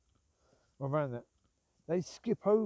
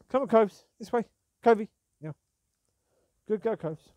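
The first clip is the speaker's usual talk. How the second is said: loud, higher call to the dog; 4 semitones higher, 8 dB louder than usual. Real, not acted. Owner calls her dog Coves to come, loud higher call. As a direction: call her over from a distance.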